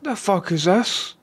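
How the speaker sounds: high-pitched, drawn-out bewilderment; slow, incredulous high voice; baffled chat viewers. Retold or imitated. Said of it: "people in my chat were like".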